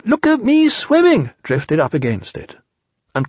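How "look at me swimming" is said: put-on high, slow voice of a small child bubbling with pride; proud little Roo. A narrator's reading. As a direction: squeak it out, thrilled with himself. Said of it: "still bubbling proudly".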